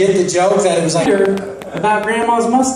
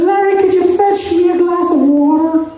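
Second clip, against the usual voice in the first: very high, sustained piping voice; mock high-pitched request; high-pitched stepdad; 9 semitones higher than usual. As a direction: do the stepdad in a silly high register. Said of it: "that's how he talks".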